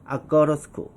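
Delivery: "아꼬러스쿠" run together as one word; "I go to school" reduced to "ah-go-ruh-skool". Shown as a demonstration. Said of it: "한 번 더 갑니다". ("아꼬러스쿠"로 한 단어처럼 이어서)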